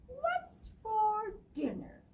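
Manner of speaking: high, slow eager question; high mouse voice. Acted. Thistle asking about dinner, high. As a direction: ask it like a small mouse. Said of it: "And Thistle says".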